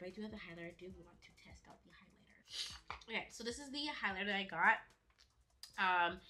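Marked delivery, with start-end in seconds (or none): 0.7-3.1 whispering. Real, not acted.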